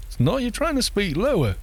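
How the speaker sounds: high-pitched retort; lifted, disbelieving contradiction; mock objection. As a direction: imitate a friend calling the bluff. Retold objection to the voice.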